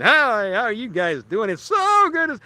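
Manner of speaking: mock announcer voice, chirpy and pushed; cheesy radio announcer. Shown as a demonstration. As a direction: ham up the small-town radio host greeting. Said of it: "I could always talk like this".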